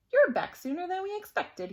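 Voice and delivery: a touch of surprise in the principal's voice; lightly acted startled welcome; surprised principal. A narrator's reading. Surprised principal greets teacher.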